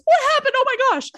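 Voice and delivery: match their excitement, way up high; mirroring excitement. Shown as a demonstration. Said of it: "a mirror goes".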